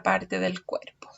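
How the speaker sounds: whispered answer. Acted. Teacher hinting the answer to children, whispered. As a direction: let the answer slip out in a whisper.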